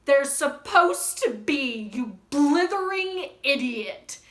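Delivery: loud, drawn-out exasperated scolding; scolding shout. Acted. Professor scolding the caretaker, slow loud shout. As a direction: shout it slowly, stressing each insult. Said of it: "shouted McGonagall".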